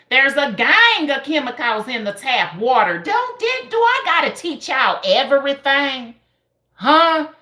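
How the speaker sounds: loud, high-pitched exasperation; exasperated scolding. Real, not acted. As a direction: scold viewers who should know this already.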